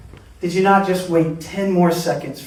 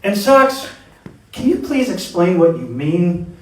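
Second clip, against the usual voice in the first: normal talking voice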